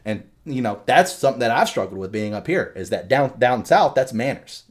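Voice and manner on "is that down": normal talking voice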